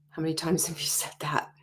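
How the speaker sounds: normal talking voice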